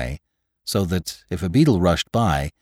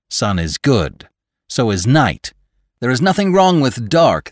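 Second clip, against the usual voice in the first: rising, louder insistence; insistent assurance; 5 semitones higher, 5 dB louder than usual. A narrator's reading. Townsfolk insisting to him there's nothing wrong with dark, insistent. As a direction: build steadily higher and louder to insist. Townsfolk's claim, voiced in story.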